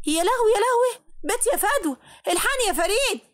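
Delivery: a frantic mother calling her son for help; panicked wail. A narrator's reading. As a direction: shriek for help as if your daughter collapsed. Mother finds daughter fainted.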